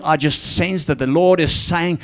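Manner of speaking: ordinary talk, nothing marked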